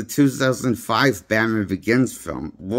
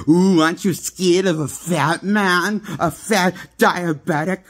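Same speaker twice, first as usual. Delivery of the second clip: strained, higher-pitched spoof of a scary Batman; hammed-up Batman menace, pitched up; mocking Batman voice; 7 semitones higher than usual. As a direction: taunt like a silly superhero trying to scare. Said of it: "That's what his Batman voice sounds like".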